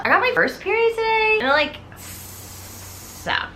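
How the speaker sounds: put-on high, fast teen whine fading out; whiny teen Carrie. Carrie tells her mom about her first period, whining. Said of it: "Carrie's just like".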